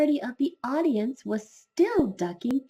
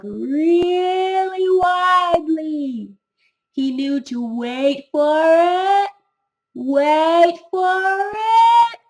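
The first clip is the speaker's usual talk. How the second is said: suspenseful build; 8 semitones higher, 8 dB louder, much slower than usual. A narrator's reading. Narrator builds belch suspense.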